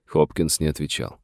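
ordinary delivery, nothing marked

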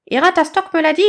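high, loud insisting squawk; insistent bird chick. Acted. Chick insisting to badger, high and loud. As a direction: press the point in a rattly chick voice. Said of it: "insista l'amas de plumes".

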